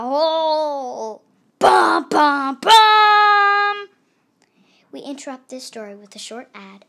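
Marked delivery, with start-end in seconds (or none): 0.0-3.9 louder, slow build to a loud bum bum bum; dramatic doom. Acted. Narrator marking the wolf's gulp with a loud dramatic sting.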